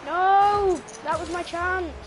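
high, loud cry of protest; dismayed outcry. Real, not acted. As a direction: wail over a missed chance, stretching the end. Misses a chance in game.

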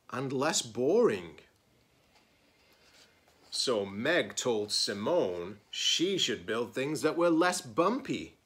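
lively picture-book read-aloud; story narration. Acted. Librarian reading a picture book to her students, expressive storytelling. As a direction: read it like story time for kids.

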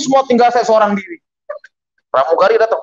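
ordinary talk, nothing marked

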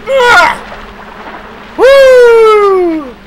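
high loud woo; excited whoop. Real, not acted. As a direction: whoop after the leap. Leap cheer.

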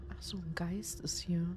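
hushed scared whisper. Real, not acted. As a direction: breathe it out, barely voiced. Sneaking past a ghost.

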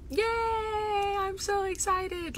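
very high, drawn-out cheer; excited squeal. Real, not acted. Telling viewers about the new podcast, excited squeal. Celebrating her podcast announcement.

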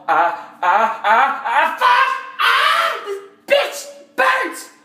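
high, loud cries of pain; screaming from peroxide burn. Acted. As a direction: howl as the sting hits. Peroxide on cut.

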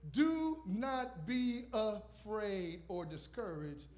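emphatic sermon proclamation, loud then slowing; preaching. Acted. Preacher proclaiming scripture to the congregation, emphatic. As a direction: preach the verse with weight.

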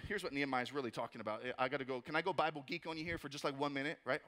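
usual speaking voice

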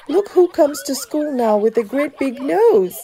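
high, mocking schoolkid jeer; high-pitched playground tease; teasing. A narrator's reading. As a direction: taunt a classmate in a put-on kid voice. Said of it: "they teased".

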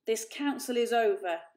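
loud final pronouncement; angry decree. A narrator's reading. Father ending the council, loud and angry. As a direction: end it with authority. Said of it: "blazed Father".

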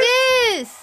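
loud high wail; anguished shout. Real, not acted. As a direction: cry out the name. Wrestler loses.

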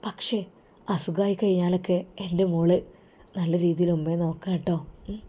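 husband quietly comforting his wife before going abroad; tender husband. Acted. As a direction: soothe her gently before he leaves.

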